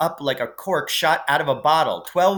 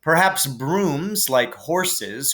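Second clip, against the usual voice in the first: plain reading voice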